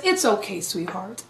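low, soft, fading reassurance; lightly voiced older woman soothing someone; gentle elder lady. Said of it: "she looks at me and she says".

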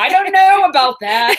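high, loud mock Irish accent; attempted Irish brogue. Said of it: "Give me a nice thick Irish brogue".

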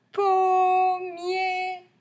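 Pommier high and loud, both syllables held long. Shown as a demonstration. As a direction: sing out POM, MIER slowly. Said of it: "Deux syllabes".

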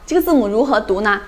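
usual speaking voice

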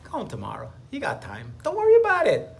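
lightly voiced coaxing inner voice; mild mock tempting voice; lizard brain voice. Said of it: "The lizard brain says".

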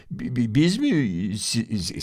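bi-, bi-, biz mi: stammered syllables; stammering. A narrator's reading. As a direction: stutter nervously over the start. (kekeleyerek, sinirli)

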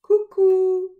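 loud, high cuckoo calls. Acted. Narrator calling like the cuckoo bird, loud and high. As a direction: sing it like a cuckoo clock bird. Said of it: "the cuckoo bird says".

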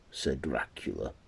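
ordinary delivery, nothing marked

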